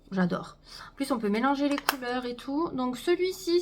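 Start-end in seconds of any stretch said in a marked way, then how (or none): none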